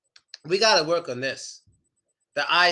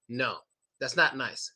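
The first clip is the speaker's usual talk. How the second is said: ordinary delivery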